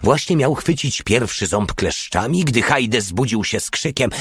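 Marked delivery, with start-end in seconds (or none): none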